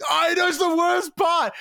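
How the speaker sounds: high, loud, laughing outburst; exasperated shout. Real, not acted. Agreeing with co-host about the cancellation, loud exasperated outburst. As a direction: blurt it out in shared frustration.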